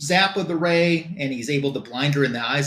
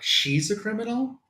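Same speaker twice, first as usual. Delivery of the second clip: higher-pitched retold disbelief; mock-indignant query, pitched up; incredulous retort; 8 semitones higher than usual. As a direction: question it as if it's absurd. Retold reaction to criminal accusation.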